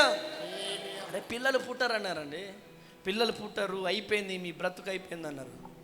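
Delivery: normal talking voice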